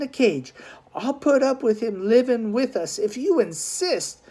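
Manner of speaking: a hint of a stern, haughty man; suitor Damaris. A narrator's reading. Man complaining about the brother to Noreen, lightly voiced haughty disdain.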